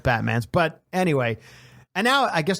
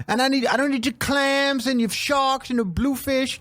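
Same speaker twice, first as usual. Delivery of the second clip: high, put-on confrontational voice; Bahad Bahabi; 10 semitones higher than usual. Imitating her confronting a clam underwater, mock-indignant. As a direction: pick a fight in a pitched-up mock voice. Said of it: "So Bahad Bahabi's down there at the bottom of the ocean going".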